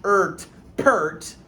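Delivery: "ert, pert" blended slowly from its sounds; blending sounds. Shown as a demonstration. Teacher blends "pert" for the students. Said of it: "This says er".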